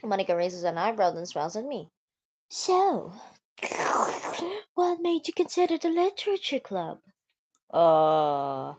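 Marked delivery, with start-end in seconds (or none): 2.5-7.0 put-on sweet girl voice. Voicing Monica sweetly.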